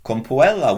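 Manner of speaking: puella with the final syllable stretched long. Shown as a demonstration. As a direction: draw out the last A of puella. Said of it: "Elongate your final syllable".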